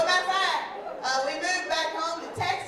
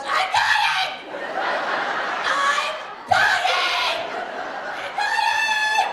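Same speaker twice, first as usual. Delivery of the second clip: loud, shrieking high mock wail; hammed-up whining; 5 dB louder, much slower than usual. Partner heard from the bathroom during prep, hammed-up whining. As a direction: wail it out, imitating a dramatic hypochondriac. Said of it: "The amount of whining".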